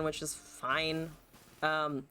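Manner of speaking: normal talking voice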